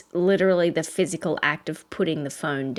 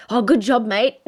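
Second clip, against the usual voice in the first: put-on Australian accent; 2 semitones higher, 4 dB louder than usual. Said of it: "both my husband and I have Australian accents".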